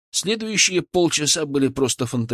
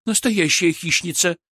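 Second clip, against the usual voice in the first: high, impressed exclamation; delighted awe, pitched up; admiring; 6 semitones higher than usual. Acted. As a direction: marvel at the catch. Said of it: "восхитилась Кристина".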